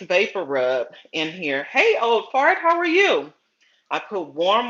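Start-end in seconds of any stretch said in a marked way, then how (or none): 1.6-3.3 high, delighted teasing hello; excited greeting. Real, not acted. Greeting a viewer on livestream.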